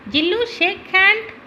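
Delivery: high, sing-out command to a pet; dog command. Acted. Boy telling his puppy to do a trick, high command.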